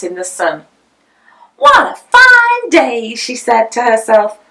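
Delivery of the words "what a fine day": much higher, louder, slow prim lady; a house-proud lady beaming at the weather, high and slow; cheerful Missus Potter. A narrator's reading. Mother voiced by narrator.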